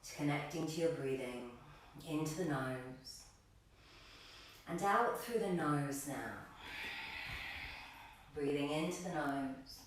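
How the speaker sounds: calm, low-key breathing cues, letting phrases settle; guided meditation. Acted. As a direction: guide them gently into stillness, unhurried.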